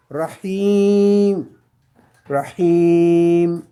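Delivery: Rahim with the hi held for two beats, falling; long i in Rahim. Shown as a demonstration. Teacher showing the long vowel to students, a drawn-out Rahim. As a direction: stretch the i of Rahim. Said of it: "pour le prolonger de deux".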